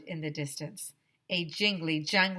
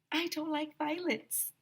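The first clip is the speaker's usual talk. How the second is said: put-on prim girl voice; 9 semitones higher, 5 dB quieter than usual. Prim child Andrea objects.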